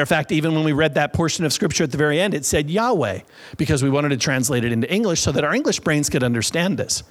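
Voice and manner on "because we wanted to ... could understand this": usual speaking voice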